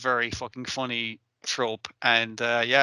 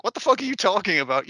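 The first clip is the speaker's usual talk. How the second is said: higher-pitched baffled retort; mock incredulity; 5 semitones higher than usual. Council members answering the plan to destroy deer club, mock incredulity. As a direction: throw it back in disbelief.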